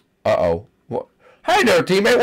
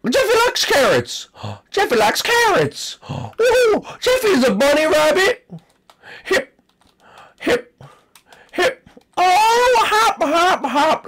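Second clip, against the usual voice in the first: put-on high, gasping, thrilled kid; overjoyed little-boy squeal; excited squeal; 12 semitones higher than usual. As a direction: squeal with delight at the carrots. Excited discovery in game.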